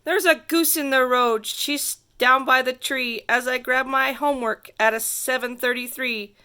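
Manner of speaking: plain reading voice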